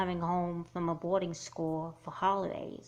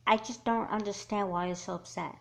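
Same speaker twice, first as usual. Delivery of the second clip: ordinary talk, nothing marked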